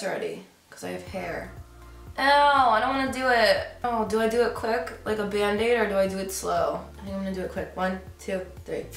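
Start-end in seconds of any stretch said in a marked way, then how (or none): none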